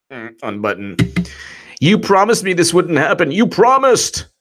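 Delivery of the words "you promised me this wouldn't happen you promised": higher, louder hammed-up whine; mock whiny complaint. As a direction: whine like a betrayed child. Mocking agent's complaint.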